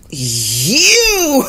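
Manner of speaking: long drawn-out, high accusing cry; mock seething. Facing the traitor after decades, mock seething. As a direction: swoop on the word in hammed-up outrage. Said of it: "the smoke comes out my ears".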